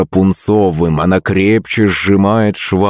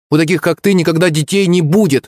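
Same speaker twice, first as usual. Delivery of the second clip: high-pitched spiteful schoolgirl; taunting girl; 11 semitones higher than usual. A narrator's reading. Redhead girl answering Yulenka's threat, taunting. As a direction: jeer in a high girlish voice.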